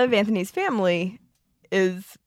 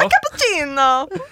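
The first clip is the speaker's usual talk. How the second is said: mock Italian; 6 semitones higher than usual. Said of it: "He could be like".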